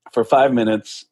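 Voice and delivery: ordinary talk, nothing marked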